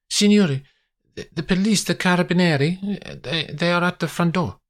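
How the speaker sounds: high, urgent, stammering Italian woman; flustered Italian maid. Acted. Maria rushes into the study to announce police, flustered. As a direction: burst in with alarming news, pitched right up.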